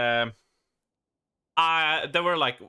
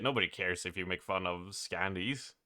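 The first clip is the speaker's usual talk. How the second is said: usual speaking voice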